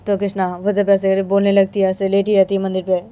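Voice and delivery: plain reading voice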